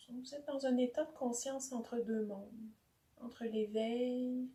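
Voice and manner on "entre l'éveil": ordinary talk, nothing marked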